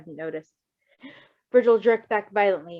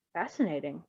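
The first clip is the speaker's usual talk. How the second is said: softer, breathed out in wonder; 3 semitones lower, 6 dB quieter than usual. Acted. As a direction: murmur it in quiet awe. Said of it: "Bluebird breathed".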